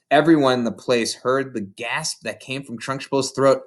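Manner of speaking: usual speaking voice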